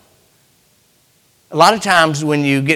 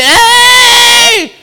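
high shout to a passing ship; calling out; 7 dB louder than usual. Pretend. Preacher hailing a passing ship, shouting. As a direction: hail the ship at the top of your voice.

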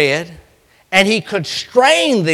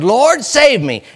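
slow, higher, louder plea; cry for help; 6 semitones higher, 5 dB louder, slower than usual. Acted. Sinking Peter to the Lord, loud slow plea. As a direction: cry out slowly for rescue.

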